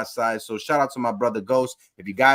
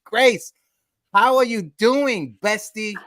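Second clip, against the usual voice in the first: higher, louder hello to the co-host; excited greeting; 7 semitones higher, 4 dB louder than usual. Real, not acted. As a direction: call out to your bestie.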